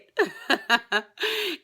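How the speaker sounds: high, giddy laugh of joy; happy laugh. Real, not acted. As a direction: laugh out the excitement. Laughing after announcing excitement.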